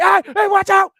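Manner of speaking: high, loud "watch out"; acted alarmed shout of warning. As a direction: yell a warning at a friend about to be hit. Warning as axe head flies.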